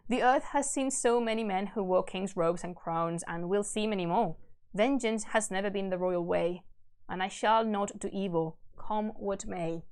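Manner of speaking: low, measured verse reading; stately, subdued storytelling; solemn epic narration. A narrator's reading. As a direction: read it gravely, letting the phrase ends settle. Reads epic aloud.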